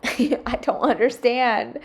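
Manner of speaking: slow, laughing disbelief; laughing exasperation. Real, not acted. Mom laughing in disbelief at the dirty sink. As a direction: drag it out through a laugh. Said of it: "Why can't my family do the same?".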